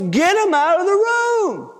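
high, insistent grandpa command; imitated grandpa losing patience; forceful order. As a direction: order everyone out, pitched high. Grandpa repeats order more forcefully.

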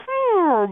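high, limp deflating hum; high falling "hmm" for a feeble airbag; flimsy airbag noise. Retold or imitated. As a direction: imitate an airbag flopping out feebly. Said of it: "flimsily came out and was like".

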